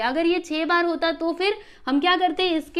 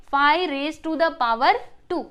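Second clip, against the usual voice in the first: five raise to the power two read slowly and clearly; slow demo reading; 4 dB louder, much slower than usual. Shown as a demonstration. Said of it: "चलो read करके बताओ जल्दी से".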